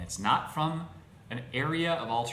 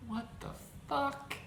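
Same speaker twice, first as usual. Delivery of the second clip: "fuck" pitched way up, a squeaking rise of disbelief; incredulous squeak; 6 dB quieter than usual. Real, not acted. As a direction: let "What the fuck?" climb high in outrage. Incredulous at brand called racist.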